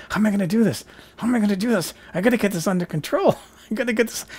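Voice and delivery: high, panicky self-talk with laughter creeping in; mock panic. Actor to herself mid-scene, trying not to laugh, mock panic. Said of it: "it was just sheer panic".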